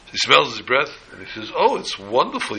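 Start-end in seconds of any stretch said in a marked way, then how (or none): 1.5-2.6 high, gushing mock praise; flattering wolf. Wolf to the lion after smelling his breath, high flattery. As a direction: gush falsely with the pitch swooping up.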